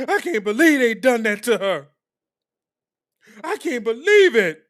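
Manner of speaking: loud, high fake wail; mock distraught disbelief. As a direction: sarcastically play the heartbroken sympathizer. Mock disbelief as a duped viewer.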